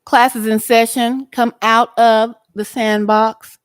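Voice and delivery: mock school announcement, projected; calling class to order.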